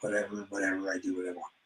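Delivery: dismissive fading whatever; fading mumble. Retold or imitated. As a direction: let the repeat die away to a mutter. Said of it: "I keep telling him".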